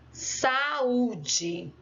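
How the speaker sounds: "saúde" said slowly with the ú drawn out; tônica demorada. Shown as a demonstration. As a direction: linger on the ú of "saúde". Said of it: "eu sempre vou demorar mais".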